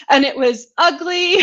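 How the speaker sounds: a rueful laugh through the words. Real, not acted. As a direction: laugh it off while admitting it. Laughing about her burnout.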